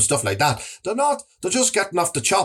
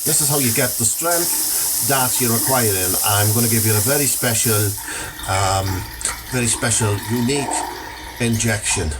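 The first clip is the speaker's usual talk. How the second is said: low dodgy dealer; 6 semitones lower than usual. Acted. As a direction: lower it like a shady gym dealer.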